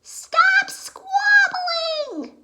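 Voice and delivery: high, loud shriek; shrieking scold from inside the cottage; yelling. Acted. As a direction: scold the fighting kids at full shriek. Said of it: "he heard her yelling inside".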